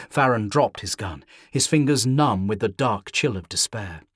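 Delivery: usual speaking voice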